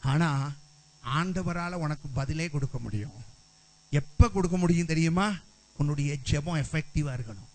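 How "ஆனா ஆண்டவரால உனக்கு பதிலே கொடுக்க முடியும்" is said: usual speaking voice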